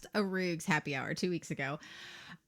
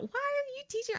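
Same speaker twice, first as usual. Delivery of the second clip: high mocking posh-mom voice; the daughter's school moms calling to complain, mocked in a high voice; 3 dB quieter than usual. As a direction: squeak it like a scandalized mom. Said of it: "poosty stay-at-home moms".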